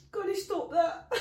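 high, loud laughing plea. Retold or imitated. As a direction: beg through laughter. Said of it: "I was like".

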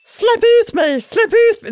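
high, loud squeaky plea to get out; tiny creature shouting from inside a bottle; trapped spirit. Acted. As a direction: yelp it far above your own voice. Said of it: "hörde någon med dov stämma".

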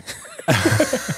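laughs high and breathy; high burst of laughter. Real, not acted. Laughing at the story.

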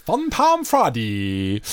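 slow Irish-accented Fun time Friday; his own Irish accent. Shown as a demonstration. Host showing his own Irish accent on the show name. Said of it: "That sounds like my Irish accent".

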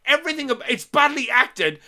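high-pitched, emphatic tirade; worked-up, exasperated listing; indignant rant. Retold or imitated. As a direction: reel off each fault in disbelief. Ranting about how bad it is.

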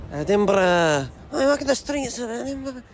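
put-on Scottish accent starting weak and growing broader; walking Edinburgh in a building Scottish accent, Billy Connolly by the end. Recording a voice note in the street, Scottish accent building up. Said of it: "You went Billy Connolly in the end".

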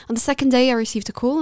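ordinary talk, nothing marked

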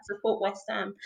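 hushed, almost under the breath, with a small laugh; owning up softly to being a fan; quiet. Retold or imitated. Confessing to friends which club she supports, quietly. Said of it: "normally I keep very quiet".

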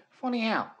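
high, tough New York "Funny how?"; New York accent. Shown as a demonstration. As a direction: snap it out in a New York gangster voice. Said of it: "do a New York accent and just say".

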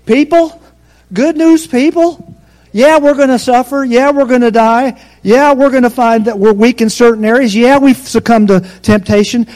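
preaching at full force. Acted.

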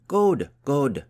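loud, high cheer of approval. A narrator's reading. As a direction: cheer your pupil on. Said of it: "cheered Yadith".

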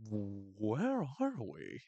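very slow dazed voice; tripping Trav. Retold or imitated. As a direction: drift through it, lost. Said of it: "he's like".

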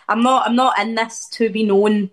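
ordinary delivery

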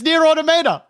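"Automata" as auto-MAY-duh, high and quick; American pronunciation. Shown as a demonstration. Friend announces the finding, American pronunciation of "Automata" as auto-MAY-duh.

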